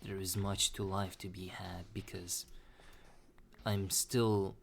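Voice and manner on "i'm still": normal talking voice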